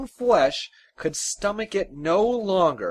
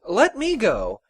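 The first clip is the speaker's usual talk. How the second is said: eager, higher-pitched plea; piping up; 6 semitones higher, 4 dB louder than usual. A narrator's reading. Fearless son volunteers to his father, piping up eagerly. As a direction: pipe up keenly to volunteer. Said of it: "Fear Not piped up".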